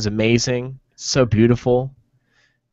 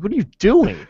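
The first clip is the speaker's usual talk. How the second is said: alarmed yell; 9 semitones higher than usual. Retold or imitated. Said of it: "I'm like".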